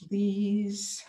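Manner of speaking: usual speaking voice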